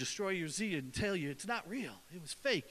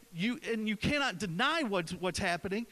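normal talking voice

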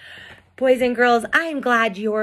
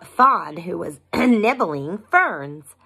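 usual speaking voice

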